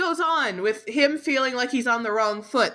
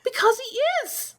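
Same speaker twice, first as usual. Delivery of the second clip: much higher-pitched insistence; exasperated. Real, not acted. Exasperated retort about hated author.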